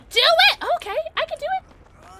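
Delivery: high-pitched burst, loud then easing; loud, high exclamation settling down; excited outburst. Real, not acted. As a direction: squeal it with excitement. Hyped reply during game.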